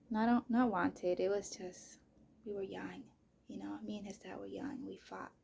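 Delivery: ordinary delivery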